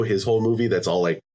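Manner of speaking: ordinary talk, nothing marked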